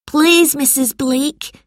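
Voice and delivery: put-on high, slow boyish plea; pleading schoolboy. A narrator's reading. George appeals to angry teacher, high pleading voice.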